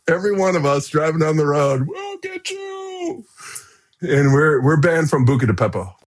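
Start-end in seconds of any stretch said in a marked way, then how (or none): 1.8-3.2 mock taunting yell. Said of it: "as we screamed".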